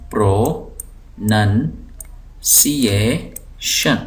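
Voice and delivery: pronunciation drawn out syllable by syllable; بہت آہستہ تلفظ؛. Shown as a demonstration. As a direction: say پروننسیشن very slowly, breaking it pro-nun-si-ay-shun. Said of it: "اس کو ہم پڑھتے ہیں". (پروننسیشن کو ٹکڑوں میں بہت آہستہ پڑھنا)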